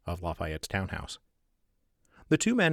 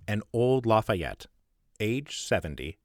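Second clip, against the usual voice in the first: plain reading voice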